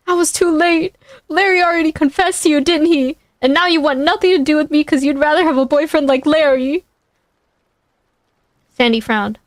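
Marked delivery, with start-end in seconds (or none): 0.1-6.8 whiny sobbing. Acted. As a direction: wail like a heartbroken boy who thinks he lost out. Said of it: "sobbing into them".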